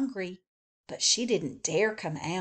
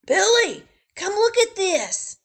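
high, loud father calling his son; 12 semitones higher, 9 dB louder than usual. Acted. Father calling Billy to the paper, high and loud. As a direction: call Billy over. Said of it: "the father ... said".

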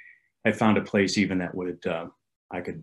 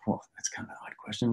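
under his breath, softer; whispered aside; 8 dB quieter than usual. Retold or imitated. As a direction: whisper the private thought. Narrator's inner aside to himself.

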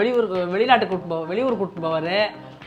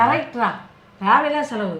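higher, openly declared agreement; raised voice; 4 semitones higher than usual. Acted. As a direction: agree aloud so she hears. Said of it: "first கத்தி சொல்லிடுற". (குரலை உயர்த்தி)

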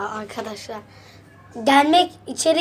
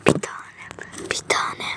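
whispering; 8 dB quieter than usual. Real, not acted. Softly repeating to herself.

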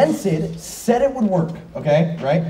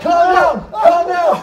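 shouting; 9 semitones higher, 5 dB louder, slower than usual. Acted. Shouting at friend.

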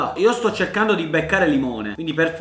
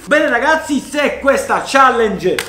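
YouTuber outro; 7 semitones higher, 6 dB louder, slower than usual. Acted.

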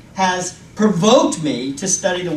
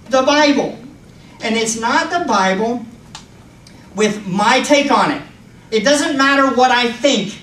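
ordinary delivery

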